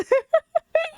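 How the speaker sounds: high, loud cackling laughter; real laughing fit. Real, not acted. Streamer set off by chat about acid reflux, laughing fit. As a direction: crack up in a high burst. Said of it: "laughing so much this fucking stream".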